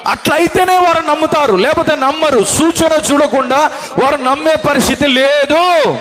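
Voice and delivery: high, ringing sermon climax shouted to the hall; full-force preaching. Acted. Preacher proclaiming signs to the congregation, full-force preaching. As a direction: preach it out with fire.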